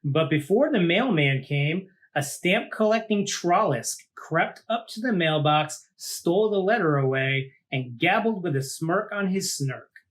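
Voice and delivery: put-on lively read-aloud for children; story narration. A narrator's reading. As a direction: tell it like a picture book to a class. Reads picture book aloud.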